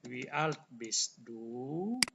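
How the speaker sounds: very slow, each word spelled out; slow teacher demo with a rise on "du"; slow model question. Shown as a demonstration. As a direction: say "Wie alt bist du?" slowly for students to copy. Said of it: "ini cara tanya ya".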